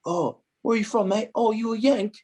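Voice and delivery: high-pitched imitation of teasing Englishmen; mocking English players. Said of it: "like, making fun of me".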